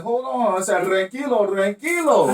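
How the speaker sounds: calming him down. Retold or imitated. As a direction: settle an angry friend, easy now. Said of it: "I'm like".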